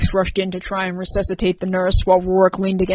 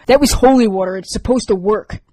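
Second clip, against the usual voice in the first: angry insistence; 2 semitones higher, 7 dB louder than usual. Acted. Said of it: "unscatable anger".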